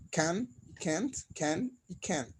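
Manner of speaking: Can and can't said slowly and cleanly, vowel as written; clear model pronunciation. Shown as a demonstration. Teacher showing Spanish speakers her own can, can't. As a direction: spell out can and can't just as they read. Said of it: "yo lo digo como suena".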